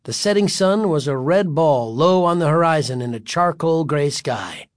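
plain reading voice

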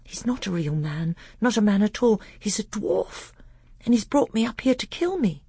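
put-on high-pitched, alarmed boy's voice; rising panic at a frightening realization; terror. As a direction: think it in a frightened, high young voice. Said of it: "One was a thought of terror".

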